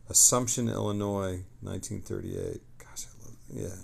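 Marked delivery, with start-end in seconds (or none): 2.8-3.8 a soft unvoiced whisper to himself; whispered aside. Real, not acted. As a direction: breathe it under the breath. Muttered aside while reading.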